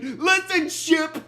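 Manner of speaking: high, loud acted threat; hammed-up irritated warning, words drawn out; annoyed. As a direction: lay down the law in mock anger. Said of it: "Bender gets annoyed with the ship".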